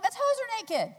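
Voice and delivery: playful high wail; high, loud sing-song quote. Retold or imitated. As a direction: squeal it like a small tragedy. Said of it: "I'm like".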